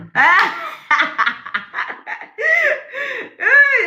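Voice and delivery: a big shrieking burst of laughter. Real, not acted. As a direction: let the laugh explode high and long. Bursts out laughing at own story.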